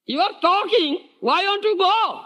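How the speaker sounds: high, insistent chiding voice; scolding the deity. Retold or imitated. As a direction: press the idol to get up and go.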